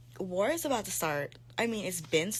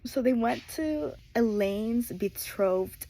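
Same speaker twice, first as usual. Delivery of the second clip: slow, louder, half-accidental British-style "betrothed"; slipping into an accent; 3 semitones higher, 5 dB louder, slower than usual. Real, not acted. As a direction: say "betrothed" as if reading in a light accent. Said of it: "why am I, like, having an accent".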